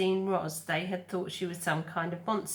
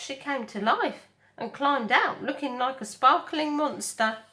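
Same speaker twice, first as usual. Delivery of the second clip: chatty otter voice; 4 semitones higher, 4 dB louder than usual. Acted. Otter tells story.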